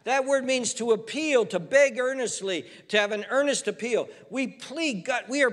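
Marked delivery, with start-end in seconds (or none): none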